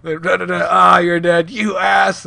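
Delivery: mock-excited trash talk among friends; excited gamer banter. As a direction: play a rowdy game night, building to a loud shout. Acting out friends playing at a party.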